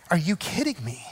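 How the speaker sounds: low, soft, restrained exasperation; understated indignation, dropped low and quiet; acted frustration. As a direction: play quietly ticked-off disbelief. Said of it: "He's frustrated".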